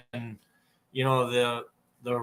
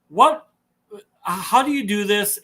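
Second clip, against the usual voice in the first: excited question; 8 semitones higher, 6 dB louder than usual. Retold or imitated. Retelling the burnout guy's excitement.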